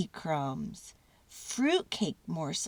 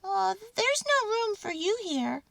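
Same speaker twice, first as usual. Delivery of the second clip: high, squeaky little mouse voice; 12 semitones higher than usual. A narrator's reading. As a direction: squeak it like a tiny mouse catching on. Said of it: "Mortimer said".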